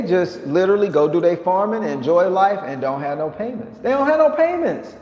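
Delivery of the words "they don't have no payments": squeaky emphatic repeat. Acted.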